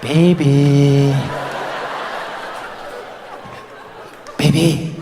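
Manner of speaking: low, needy bedtime pestering; hammed-up low croon; horny boyfriend in bed. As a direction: imitate an amorous partner nudging someone awake. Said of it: "this horny little rabbit behind you".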